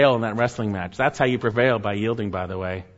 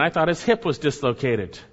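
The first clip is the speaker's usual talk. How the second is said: usual speaking voice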